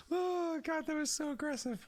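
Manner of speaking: high-pitched, talking through laughter; helpless laughter. Real, not acted. Game master cracks up at own joke, high helpless laughing. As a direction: squeeze the words out while cracking up.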